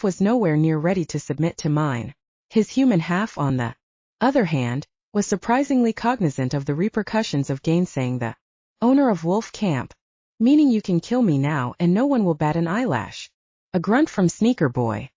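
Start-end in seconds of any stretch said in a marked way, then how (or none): none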